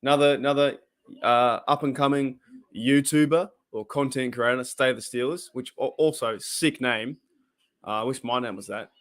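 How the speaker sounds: normal talking voice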